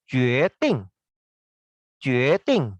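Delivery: Jue Ding said with Jue rising, Ding falling; Mandarin tones. Shown as a demonstration. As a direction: rise on Jue, drop on Ding. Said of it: "Jue nada dua".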